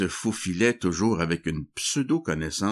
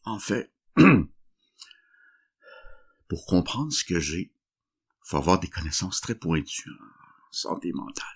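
shaky voice. Acted. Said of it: "un genre de tremblement dans la voix".

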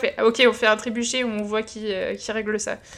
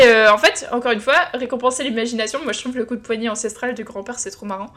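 ordinary talk

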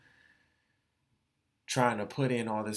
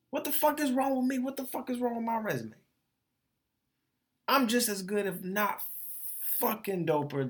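exasperated outburst; 10 semitones higher than usual. Real, not acted. Venting self-doubt to listeners.

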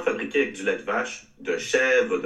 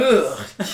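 disgusted groan; 5 semitones higher, 8 dB louder than usual. Real, not acted. Disgusted reaction to smelly cheese.

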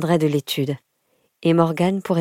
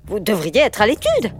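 higher, indignant headmistress voice; stern questioning; 6 semitones higher than usual. Acted. Headmistress questions a pupil out of bounds, stern. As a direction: take the child to task.

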